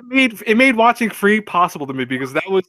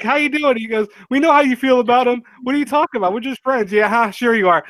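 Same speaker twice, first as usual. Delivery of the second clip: pitched-up, playful mock voices; high teasing character voice; 6 semitones higher than usual. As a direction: tease like a nosy friend. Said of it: "like, like".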